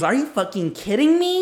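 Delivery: high-pitched mock outrage with a laugh in it; Tia imitation. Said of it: "her voice".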